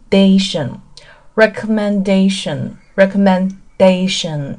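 "recommendation" said slowly, weight late on "da"; stress on DA. Shown as a demonstration. Teacher shows learners where recommendation is stressed, late stress on "dation". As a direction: lean on the "dation" of "recommendation", drawn out. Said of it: "它主要是重音是靠后的".

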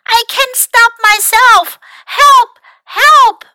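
high, loud panicked yells; yelling for help. A narrator's reading. Mac can't stop sliding, yelling for help. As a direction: cry out urgently, high and loud. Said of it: "he yelled".

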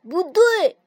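loud sharp no; shouted objection. A narrator's reading. The frog rejects the rabbit's idea, loud protest. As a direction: shout him down. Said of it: "跳跳喊道".

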